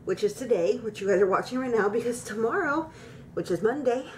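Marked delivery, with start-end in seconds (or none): none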